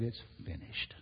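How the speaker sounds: hushed whisper, almost no voice; whispered last words. Acted. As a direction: breathe it out like a dying man. Jesus's last words on cross.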